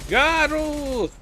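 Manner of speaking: loud call across the room. Real, not acted. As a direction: shout the name to get attention. Calling out to game character.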